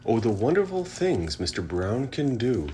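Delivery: ordinary delivery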